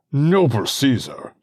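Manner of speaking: high, loud plea; protesting senator. Acted. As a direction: cry out in protest. Olympius protests.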